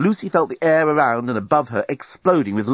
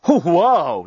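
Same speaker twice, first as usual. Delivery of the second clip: loud delighted wow; amazed exclamation; 6 semitones higher, 5 dB louder than usual. A narrator's reading. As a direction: gasp it out in wonder. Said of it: "he exclaimed".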